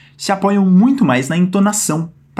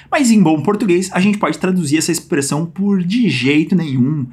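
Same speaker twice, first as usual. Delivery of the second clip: usual speaking voice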